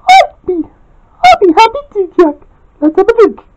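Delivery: put-on very high, cheerful girl's voice; Lisa Simpson voice. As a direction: go way up high, eager to help. Lisa answers Jack.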